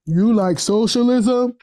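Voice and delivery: slow, mocking cop quizzing an old woman. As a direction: draw out the question like a smug officer. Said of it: "that's her cop voice".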